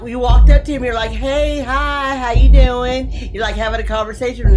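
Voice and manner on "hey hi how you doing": imitating a friendly hello, high-pitched; friendly greeting. Player retelling Trixie's hello to the monster, friendly. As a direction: chirp it like an innocent, friendly player. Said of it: "being his iconic self and being friendly".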